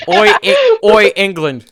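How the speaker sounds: put-on high, loud German-accented call. Germany accusing England over the phone, German accent. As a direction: shout the "Oi" as a German. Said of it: "said a German voice".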